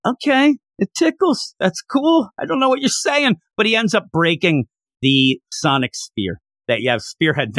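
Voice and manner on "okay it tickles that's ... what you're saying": high, incredulous mock repeat; baffled mock reaction. Real, not acted. Reviewer baffled by a line, high and incredulous. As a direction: squeak it out like you can't believe it. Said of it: "mocking confusion at the tickles line".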